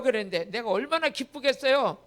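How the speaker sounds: ordinary delivery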